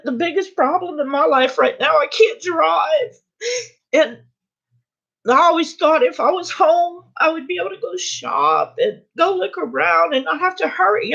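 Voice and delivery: high, upset, talking through tears; real tearful voice. Real, not acted. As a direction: keep explaining while crying. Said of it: "and here I am crying".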